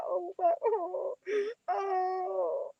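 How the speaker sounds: high, slow hammed-up mock sobbing; fake crying. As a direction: whimper it like a badly faked apology video. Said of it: "You want me to fake cry".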